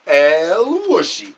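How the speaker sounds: slow, stretched El Whooshy call; drawn-out catchphrase. Pretend. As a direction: stretch it out like a showman. Repeated catchphrase bit.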